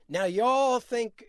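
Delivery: lightly imitated Southern preacher accent, drawn out; Andrew Young's accent. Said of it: "I'm imitating Andrew Young's accent".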